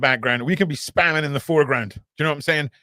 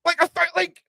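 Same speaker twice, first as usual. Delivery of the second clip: excited outburst; 7 dB louder than usual. Real, not acted. Excited outburst about the footage.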